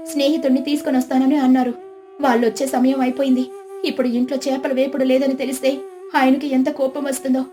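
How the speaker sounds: anxious housewife, at a loss; worried village wife. Acted. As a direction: think aloud in rising worry. Voices distressed Lakshmi.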